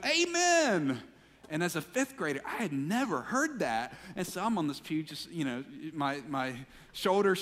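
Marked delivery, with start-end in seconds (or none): 0.1-1.0 high, loud drawn-out call; imitated churchgoer's cry, pitched way up; congregant's amen shout. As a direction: holler it from the crowd. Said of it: "somebody in the crowd goes".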